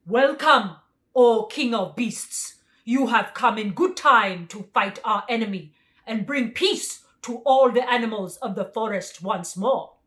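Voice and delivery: loud tiger greeting; tiger's voice. A narrator's reading. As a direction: boom out a welcome as the tiger. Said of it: "the tiger came up and bowed".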